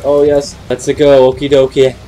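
ordinary talk, nothing marked

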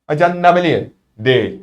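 ordinary talk, nothing marked

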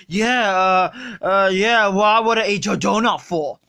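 put-on low slurred drunk voice; sluggish, drunk and lazy; Homer Simpson. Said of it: "Look, Homer".

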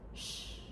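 sh as a soft hiss with no voice; teacher showing how a rest is said; rest sound. Shown as a demonstration. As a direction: say sh for the rest, just breath. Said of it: "How we say it is".

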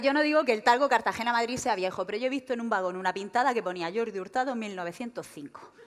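ordinary delivery

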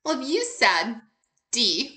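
ordinary talk, nothing marked

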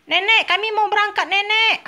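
put-on high little-child voice, eager; a toddler chirping to his mama, pitched high; baby telling Mama he wants to go, high child voice; baby Tom Tom voice. Baby calls to grandmother.

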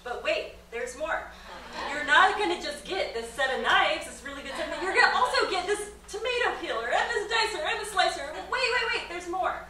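loud, high, laughing sales pitch; infomercial pitchman. Pretend. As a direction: sell it like a late-night TV ad. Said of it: "the hallmark of an infomercial".

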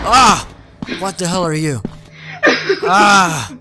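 high loud panicked ah and oh nos; panicked cries. Real, not acted. Gamer chased by a monster, crying out in panic. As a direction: cry out over and over in panic.

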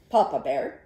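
usual speaking voice

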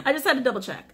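quick, high words bubbling with laughter; laughing. Real, not acted. Reader confirming the devil card, laughing with relief.

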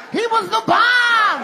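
very high, drawn-out yell; hammed-up shout. Comic shouting an explosion punchline, high and stretched. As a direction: holler it slowly at the top of your range.

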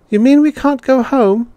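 put-on very high, loud child's protest; a little one about to cry; child protesting to the teacher in a very high voice; dismayed child.

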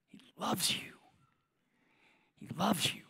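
hushed, tender repeat; whispered reassurance. Real, not acted. Preacher softly assuring listeners of God's love. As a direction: breathe it softly, like a secret.

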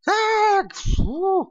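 sudden high yelp of fright; startled scream. Real, not acted. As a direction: jump at the scare, voice cracking high. Jump scare in game. (grito assustado e agudo)

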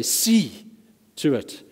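firm lift on "See"; stressed See to it. Shown as a demonstration. Preacher voicing Jesus, pitching up "See". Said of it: "as we hear him say".

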